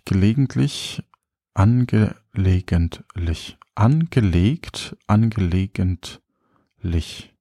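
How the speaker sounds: slowly turning the word over; drawn out, thinking it through; slow musing. Real, not acted.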